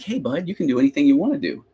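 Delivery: cool parent. Acted. Parent cheering on an unsure kid, cool parent. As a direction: encourage a kid like an easygoing parent. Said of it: "sounds like a really cool parent".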